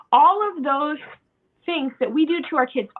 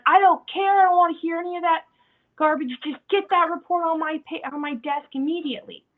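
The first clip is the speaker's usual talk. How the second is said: loud, higher-pitched angry outburst that eases off; yelling boss; 4 semitones higher, 3 dB louder than usual. Acted. Boss berates employee over forgotten report, angry yelling. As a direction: cut the excuses off and order it done. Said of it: "for your boss to yell at you".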